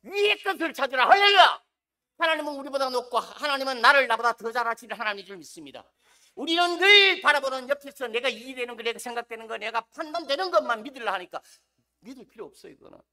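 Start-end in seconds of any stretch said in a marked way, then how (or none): 0.0-11.4 fervent preaching. Acted. Pastor urging the congregation, fervent loud preaching. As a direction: build it like a revival pastor.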